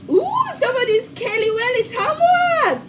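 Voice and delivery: high, thrilled squeal of recognition; real excitement, pitch shooting up; excited exclamation. Real, not acted. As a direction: cry out the town name high with delight. Excited at viewer from familiar town.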